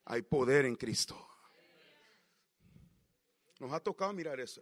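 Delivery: normal talking voice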